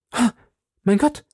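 high, startled exclamation; frightened cry. A narrator's reading. Queen hears the duke's premonition of death, frightened cry. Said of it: "mit einem Ausdruck des Schreckens".

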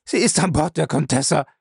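high-pitched, cried-out confession; desperate bellow. A narrator's reading. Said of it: "brüllte er dann".

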